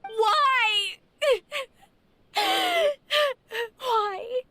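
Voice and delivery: desperate laugh turning to sobs; a cracked, tearful why; broken crying laugh. Acted. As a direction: laugh through tears at the betrayal. Betrayed friend breaks into crying laughter.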